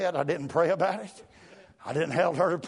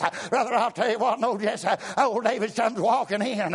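normal talking voice

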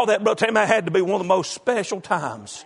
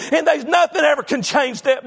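driving, raised-pitch revival preaching; full-force preaching; 4 semitones higher than usual. Real, not acted. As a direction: preach the testimony hard, building without letup. Preaches his conversion testimony at full force.

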